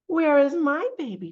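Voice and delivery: duck character. Acted. Voicing duck in picture book.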